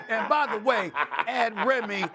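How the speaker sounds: ordinary talk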